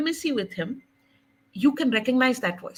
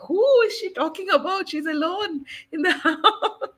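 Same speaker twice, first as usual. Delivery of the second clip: baffled onlooker; 8 semitones higher than usual. Retold or imitated. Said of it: "someone in the world will be like".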